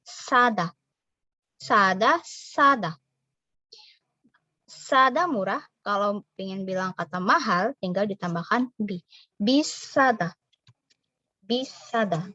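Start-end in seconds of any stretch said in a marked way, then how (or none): 0.1-2.9 Sada with a tense, strong s; thick S. Shown as a demonstration. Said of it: "harus lebih tebal S-nya". 9.3-10.4 Bisada slowly with a tense s; slow Korean demo. Shown as a demonstration. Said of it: "harus lebih tebal S-nya".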